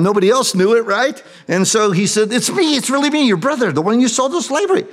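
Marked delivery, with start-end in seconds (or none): 2.3-4.8 high, pressing "it's really me"; urgent plea. Acted. Joseph pleading with the brothers who sold him, urgent and high. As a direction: make them believe it's you.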